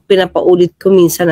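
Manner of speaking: normal talking voice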